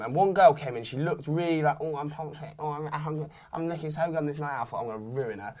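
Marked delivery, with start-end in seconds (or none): 1.8-4.6 mocking girl voice. Mocking the girl's vibe. 4.8-5.5 quiet scheming. Retold or imitated. Scheming aside, laughing.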